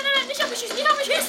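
high, loud panicked pleading; panicked yell. Real, not acted. Gamer yelling as an enemy shoots at him, panicked loud pleading. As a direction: beg them not to shoot, shrieking. Brother shot at in Fortnite.

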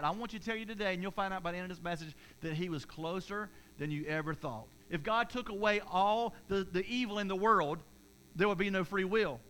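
ordinary talk, nothing marked

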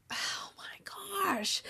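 high, whispered cry; slow tearful squeak, barely voiced. Real, not acted. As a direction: choke out a drawn-out whisper through tears. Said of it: "I was gonna cry again".

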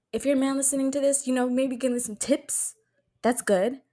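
normal talking voice

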